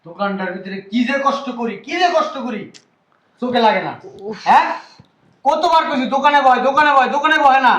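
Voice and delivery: furious rant. Acted. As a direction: vent years of frustration at the son. Father ranting at idle son. (রেগে চেঁচিয়ে বকাবকি)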